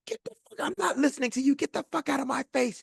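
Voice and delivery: very high imitation of a furious woman; mock screaming tirade. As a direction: pretend shriek, volume only partly let out. Retold screaming outburst at staff.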